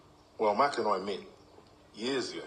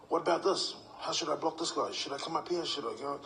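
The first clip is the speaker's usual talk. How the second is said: higher mock pestering player voice; mock eager player; 7 semitones higher than usual. Player pestering coach with questions, higher mock voice. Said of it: "when you ask too many questions".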